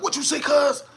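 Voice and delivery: mock a man picking a fight; imitating a man yelling at a stop sign; homeless man yelling. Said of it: "yelling at the stop sign".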